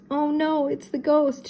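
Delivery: high-pitched mild fright; alarmed exclamation. A narrator's reading. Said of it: "Shaggy exclaimed".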